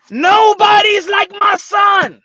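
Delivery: high, loud, slow boast; mock proud shout. Father boasting about his son, high and loud. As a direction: bellow the boast, stretched out.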